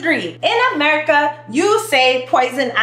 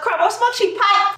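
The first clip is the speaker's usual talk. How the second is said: high-pitched Grenadian delivery; Grenadian accent; 9 semitones higher than usual. Acted.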